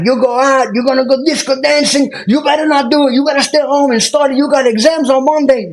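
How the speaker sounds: put-on high, loud Cuban-accented drill instructor rant; Cuban instructor yelling. Cuban drill instructor screaming weekend warnings at recruits. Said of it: "Freaking out on us".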